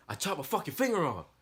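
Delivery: high, loud screaming into the phone; panicked yell. Retold or imitated. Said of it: "I'm like".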